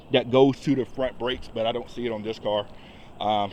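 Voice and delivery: normal talking voice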